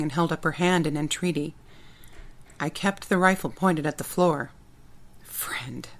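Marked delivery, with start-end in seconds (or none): 5.2-5.9 soft bitter whisper. Acted. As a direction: breathe the word, barely voiced. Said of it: "I repeated in a whisper".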